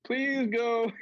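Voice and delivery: high, slow, pleading whine; high begging voice, drawn out; pleading inner voice. Retold or imitated. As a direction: beg them to take it and leave. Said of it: "and I'm like".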